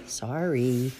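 ordinary delivery

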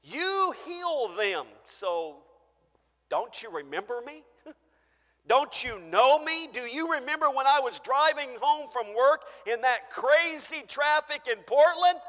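reminding God who you are, insistent; pleading believer. Acted. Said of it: "we may say that to God".

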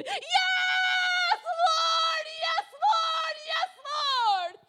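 very high, drawn-out screamed praise; yelling to the Lord. Retold or imitated. Mother crying out to the Lord for her son, high scream. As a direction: scream it out, each cry held long. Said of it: "And I yelled".

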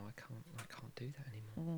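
soft whispered aside; whispering. Real, not acted. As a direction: whisper it under your breath. Aside after shutting down accounts.

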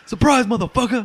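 mock TV character catchphrase; guy from Dexter. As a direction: repeat the TV line in a lightly put-on voice. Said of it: "the guy from Dexter saying".

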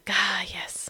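breathed, whispered "ah, yes"; blissful whisper. Acted. Voicing blissful metal daydream.

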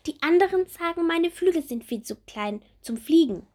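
high, bright little bumblebee; small bumblebee voice. Acted. Little bumblebee Bommel asking Marie about her wings, high childlike voice.

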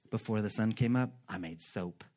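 low, soft smug boast; mock superwoman brag. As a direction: brag sweetly about the early soap. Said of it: "She does everything".

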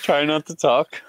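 talking between sips of tea; words around a sip of hot tea; sipping hot tea. Real, not acted. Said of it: "I'm just sipping my tea".

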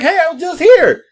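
high, loud exclaim; surprised call-out. Retold or imitated. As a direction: call out, amazed to be back. Surprised to be back there.

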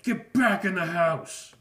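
loud, high, slow bellow; angry dad yell. Retold or imitated. Father ordering his kids inside, furious loud yell. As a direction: roar the kids back indoors.

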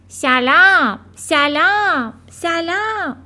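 high ringing bird call, starting very loud; loud, resounding voice. A narrator's reading. Unseen bird calling from the living room, loud and resounding. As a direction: call out each word to carry across the hall. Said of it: "صدای بلند و رسایی".

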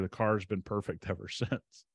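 laughing. Retold or imitated. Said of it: "And he's laughing".

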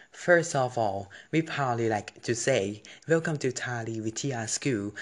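loud, slow formal speech delivery; formal welcome speech. Shown as a demonstration. Student demonstrating his welcome speech to committee, formal. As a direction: address the committee like a school representative. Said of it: "ลองพูดนะ ... เริ่มนะครับ".

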